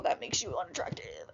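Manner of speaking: a breathy whispered put-down, barely voiced; whispered aside. Real, not acted. Muttering a jab at rude coworkers, whispered. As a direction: whisper it like a catty secret.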